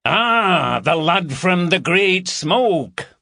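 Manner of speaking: high, loud northern hail across the corridor; ringing Yorkshire greeting, slowed on the last words; strident northern call. A narrator's reading. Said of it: "call out in a strident northern tone".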